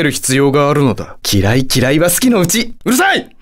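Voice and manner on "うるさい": high, sudden indignant cry; annoyed outburst. Acted. Satan silencing a cheeky challenger, annoyed outburst. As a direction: cut them off with a high-pitched yell.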